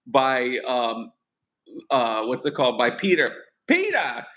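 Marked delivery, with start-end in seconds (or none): 3.7-4.2 much higher calling out to a lost son; hammed-up worried dad call; imitating a father calling his boy; mock calling father.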